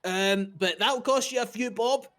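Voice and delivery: high, quick Irish shopkeeper; chirpy Irish sales pitch; Irish twang. Acted. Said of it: "it's like".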